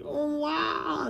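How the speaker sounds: high, drawn-out mock wail; MODOK impression. Reviewer playing with the figure imitates MODOK, high mock cry. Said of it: "enough for him to go like".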